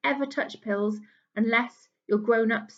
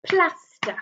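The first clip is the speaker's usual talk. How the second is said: "Plaster" in two beats, PLAS raised; clapped-out syllables; 5 semitones higher, 3 dB louder than usual. Shown as a demonstration. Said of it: "Let's clap it out".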